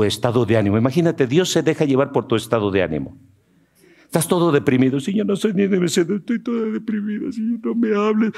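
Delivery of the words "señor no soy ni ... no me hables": whiny depressed believer. Retold or imitated. Depressed believer begs God, high and whimpering. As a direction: whine like you're too sad to listen.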